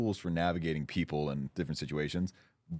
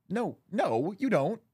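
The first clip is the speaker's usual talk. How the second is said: higher and louder, emphatic denial; insistent no; 10 semitones higher, 4 dB louder, much slower than usual. Real, not acted. To Kate, a playful, insistent contradiction. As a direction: cut her off playfully.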